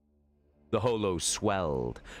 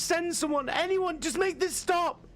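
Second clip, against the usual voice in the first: much higher, pleading voice through static; desperate begging. A narrator's reading. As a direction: beg anyone to come and save her. Woman begging for rescue.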